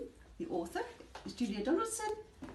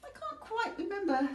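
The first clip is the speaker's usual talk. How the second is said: high, puzzled monster voice; Gruffalo voice; 12 semitones higher, 4 dB louder than usual. Acted. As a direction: hem and haw like a forgetful beast. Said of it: "the Gruffalo said".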